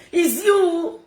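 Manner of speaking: high, loud, pointed "It's you"; loud accusation. Real, not acted. Streamer calling out her rival, loud accusation. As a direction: jab it straight at her.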